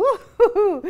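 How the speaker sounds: slow, high, loud laughing ouh; laughing hoots. Real, not acted. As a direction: hoot through the giggles. Hooting while laughing, slowed. (hululer lentement en riant)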